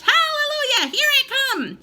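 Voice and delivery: high screechy cackling cry; old crone shrieking with delight; wild grandma crowing. A narrator's reading. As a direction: crow it out, laughing with glee. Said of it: "she shouted, finding her voice".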